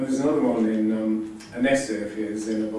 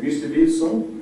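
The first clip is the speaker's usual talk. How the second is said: imitated French caller, higher and louder; a questioning phone greeting in French accent; 5 semitones higher, 6 dB louder than usual. French agency caller checking who answered, French accent. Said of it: "and the French voice said".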